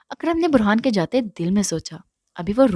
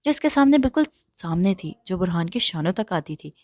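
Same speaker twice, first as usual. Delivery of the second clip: usual speaking voice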